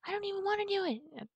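high, whiny mock of a panicking kid; mocking whiny girl. Mocking the twelve-year-old's panicked excuse, high and whiny. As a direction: squeak it out like a scared brat making excuses. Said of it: "She was like".

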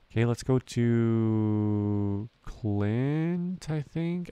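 slow, loud indecision over where to go; drawn-out dithering. Real, not acted. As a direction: drag every word while deciding. Streamer dithering over route.

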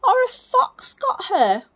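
high, loud crying owl; frightened owlet. Acted. Percy fears a fox got mother, crying. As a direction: wail the fear of the fox. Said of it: "said Percy".

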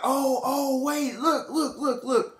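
high, stammered backpedalling; high-pitched mock-flustered woman; baby girl. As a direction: imitate a girl stalling in a high voice. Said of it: "then baby girl's like".

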